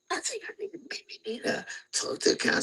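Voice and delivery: whispered, fast and timid; whispered confession. Retold or imitated. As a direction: barely breathe out the admission.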